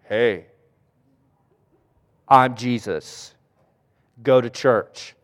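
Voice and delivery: low, slow mock-divine voice; deep, drawn-out pretend voice of the Lord; mock voice of Jesus. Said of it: "Is it like".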